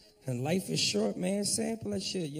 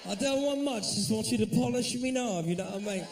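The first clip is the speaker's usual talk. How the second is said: higher-pitched mock English accent; 4 semitones higher, 4 dB louder than usual. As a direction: plead politely in a fake English accent. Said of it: "Some of the fellas might try that".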